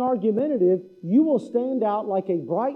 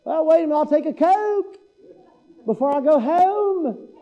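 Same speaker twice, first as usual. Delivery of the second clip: retold in a lightly drawled Southern accent, a touch higher; 6 semitones higher than usual. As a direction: play Aubrey in a mild Southern drawl. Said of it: "Aubrey said".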